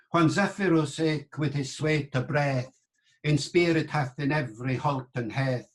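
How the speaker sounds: sonorous Middle English verse reading; Chaucer's accent. Shown as a demonstration. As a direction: declaim it in reconstructed Middle English. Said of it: "Chaucer's accent".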